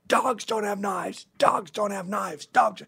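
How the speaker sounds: high, slow insistent shout; pounding shout. Retold or imitated. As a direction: hammer each word like banging a bar. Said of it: "starts banging the bar".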